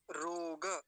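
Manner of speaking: रोग drawn out as rooo-ga; full final vowel. Shown as a demonstration. Teacher introducing the next word, slow full final vowel. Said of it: "रोग नहीं कहेंगे".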